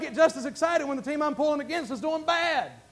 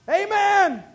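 a loud, high Amen falling at the end; preacher's loud amen; ringing amen; 8 semitones higher, 6 dB louder than usual. Acted. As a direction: call out Amen to rouse the church.

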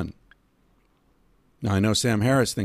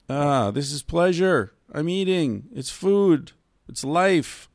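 higher, slow and relishing; savouring; 6 semitones higher, slower than usual. Acted. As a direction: savour every word like a good meal. Savouring food, mock reply.

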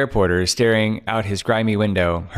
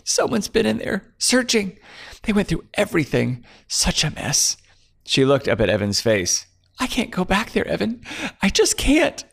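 higher, tearful frightened woman pleading; tearful fear; 11 semitones higher than usual. Acted. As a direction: hold back tears as it spills out. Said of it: "She began to weep openly".

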